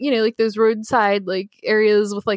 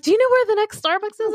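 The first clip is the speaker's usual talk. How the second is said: higher, quicker stranger asking; imitating a stranger; 9 semitones higher, faster than usual. Stranger at the closed Starbucks asks her, quick and high. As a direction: ask it fast and high like the lost man.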